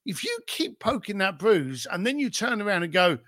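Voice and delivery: ordinary delivery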